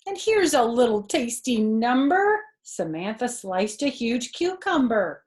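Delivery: put-on lively read-aloud for a child; animated children's story narration; storybook reading. A narrator's reading. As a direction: read it like a bedtime picture book. Reading picture book aloud.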